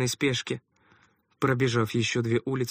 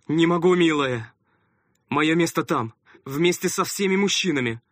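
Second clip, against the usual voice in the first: hurried, flustered speech; 5 semitones higher, 6 dB louder than usual. A narrator's reading. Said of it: "путаясь в словах, торопливо проговорил отец".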